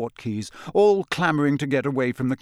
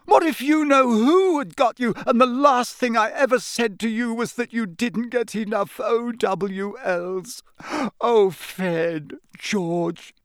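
high, loud, crying words; sobbing mother; 10 semitones higher, 4 dB louder than usual. A narrator's reading. Mother to the twins she nearly lost, sobbing. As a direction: weep out the guilt. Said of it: "starting to sob".

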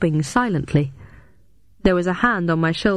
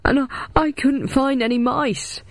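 howling; 7 semitones higher than usual. A narrator's reading. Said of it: "he howled".

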